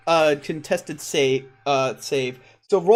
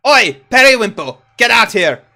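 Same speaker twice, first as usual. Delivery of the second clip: loud, raised "Oi" call to the back; 3 semitones higher, 7 dB louder than usual. Acted. Shopkeeper summons his muscle, loud shout. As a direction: holler for the big man to come out.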